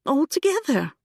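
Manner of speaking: high, amazed question; girl astonished at the idea; surprised. A narrator's reading. Younger sister amazed.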